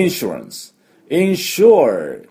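"インシュランス" as English insurance, then slow "インシュア"; English pronunciation. Shown as a demonstration. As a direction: model "インシュア" as in-SHURE with an r ending. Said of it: "これですね".

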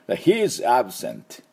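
"He is absent" said very slowly, each word spaced out; slow teacher demo of "He is absent"; slow model sentence. Shown as a demonstration. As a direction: spell it out slowly, ending on the t. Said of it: "最後、tで止めましょう".

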